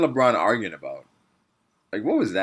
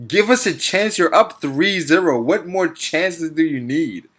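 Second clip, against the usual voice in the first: higher, drawn-out mocking echo; incredulous echo; 4 semitones higher, slower than usual. Throwing coach's complaint back at him, incredulous mockery. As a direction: repeat the plea as if it were absurd.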